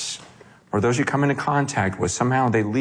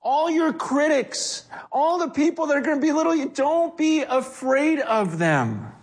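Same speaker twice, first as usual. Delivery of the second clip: urgent command; 13 semitones higher than usual. Retold or imitated. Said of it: "He goes".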